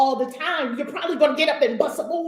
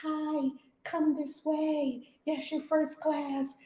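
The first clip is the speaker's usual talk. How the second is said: soft and slow, sweetly polite; lovely greeting; 4 semitones higher, 5 dB quieter, slower than usual. Retold or imitated. Airline staff greeting a first class flyer, soft and polite. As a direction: welcome them like a gracious host. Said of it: "they treat you lovely".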